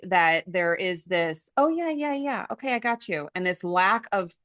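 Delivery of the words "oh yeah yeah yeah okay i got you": high, airy half-listening yeahs; mock absent-minded parent, pitched up; distracted brush-off. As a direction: toss it off like you're not listening. Mocking a distracted parent's reply.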